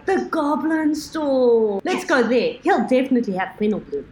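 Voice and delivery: loud eager announcement; excited. Acted. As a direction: call it out eagerly. Excited call to the party.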